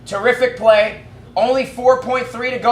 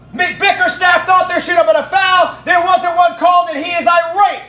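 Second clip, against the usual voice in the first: frantic play-by-play; 6 semitones higher than usual. Real, not acted. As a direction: shout the scramble as it happens. Live call of the final shot.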